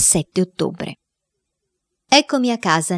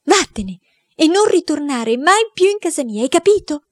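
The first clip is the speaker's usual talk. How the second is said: aunt's furious order; 10 semitones higher than usual. Acted. Aunt throws boy out.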